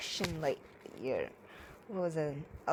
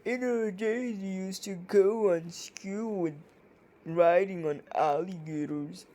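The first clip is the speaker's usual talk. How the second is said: put-on old grandfather voice; 2 semitones higher, 3 dB louder than usual. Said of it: "It sounds like my grandfather".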